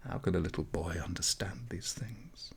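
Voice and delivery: whispered, doubting aside; soft whispered wondering; hushed question. A narrator's reading. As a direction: breathe it as a private doubt. Aunt's unspoken question, hushed.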